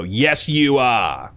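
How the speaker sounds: slow, stretched Aussie host voice; hammy Australian host reply; Flynn Diggory Doo. Retold or imitated. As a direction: draw it out in a bad Australian accent. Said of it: "cut back to him and he'd go".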